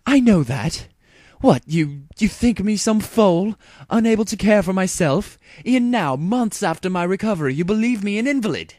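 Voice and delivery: high, loud, indignant royal fury; seething outburst. A narrator's reading. Luna lashing out at Celestia, high and furious. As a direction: lash out as the wounded younger sister. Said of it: "Luna seethed".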